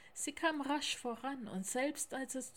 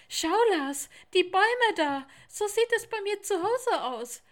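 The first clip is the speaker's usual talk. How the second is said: high, loud excited little tiger; sudden excited cry; 10 semitones higher, 13 dB louder than usual. A narrator's reading. Theo spotting his home landscape and telling Lars, excited shout. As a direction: burst out with joyful recognition. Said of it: "sagte der kleine Tiger plötzlich".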